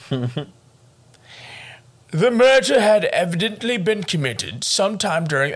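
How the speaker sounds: mock Southern newspaper read in a botched Southern accent; bad Southern accent. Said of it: "giving that accent to Atlanta".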